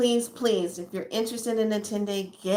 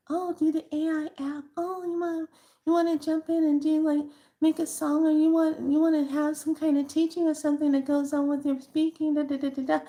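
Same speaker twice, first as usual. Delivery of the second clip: high-pitched, chattering mimicry; put-on high voice, running on into da-da-da; mocking eager app users; 7 semitones higher, faster than usual. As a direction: rattle it off high like an over-eager user.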